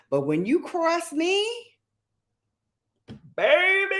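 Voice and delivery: sassy warning. Real, not acted. Warning about being crossed.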